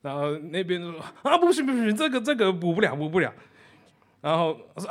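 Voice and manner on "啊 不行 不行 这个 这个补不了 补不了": flustered refusal. Retold or imitated. Operator refuses.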